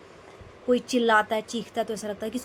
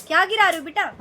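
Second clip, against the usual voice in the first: retold angry outburst; 6 dB louder than usual. Scolding her husband for interrupting her meals, rising to a loud shout. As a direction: tell him off, rising to a yell. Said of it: "मैंने उनको बहुत सुना दिया".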